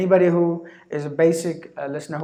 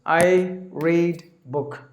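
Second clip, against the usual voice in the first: very slow, word by word; broken English demo; much slower than usual. Shown as a demonstration. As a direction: say it slowly like a learner. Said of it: "Now you're gonna try with this".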